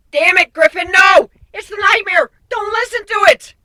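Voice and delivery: high, loud desperate yell of warning; frantic shout. A narrator's reading. Nightmare bursts in shouting at Griffin to resist. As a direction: scream at him to stop.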